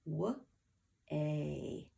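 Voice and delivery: W-a as two separate sounds, wuh, ay; isolated phonics sounds for W-a; sounding out. Shown as a demonstration. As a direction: break W-a into wuh, then a long ay. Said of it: "That A is the last thing I hear".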